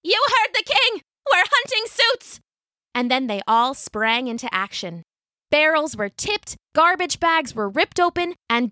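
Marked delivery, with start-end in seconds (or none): 0.0-2.4 high squeaky holler. A narrator's reading. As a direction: holler it like a tiny rallying cry. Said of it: "until Pickles hollered".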